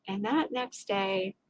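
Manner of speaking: normal talking voice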